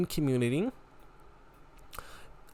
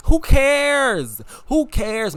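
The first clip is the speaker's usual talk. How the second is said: exasperated outburst; 7 semitones higher, 5 dB louder, much slower than usual. Real, not acted. Ranting at homophobes.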